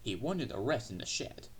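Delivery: ordinary delivery, nothing marked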